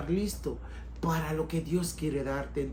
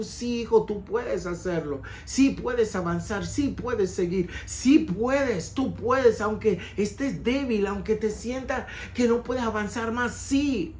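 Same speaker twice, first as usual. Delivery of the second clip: rising, louder cries of you can do it; fervent encouragement; 5 semitones higher, 4 dB louder than usual. Acted. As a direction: urge him on with growing fire. God voiced encouraging son.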